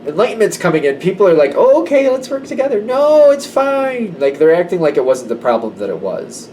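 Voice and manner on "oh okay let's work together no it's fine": mock cheery. People suddenly cooperating, fake cheer.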